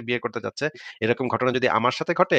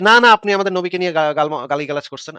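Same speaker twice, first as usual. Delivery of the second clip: retold angry protest; 4 semitones higher, 6 dB louder than usual. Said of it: "চিৎকার চেঁচামেচি করে উঠছে".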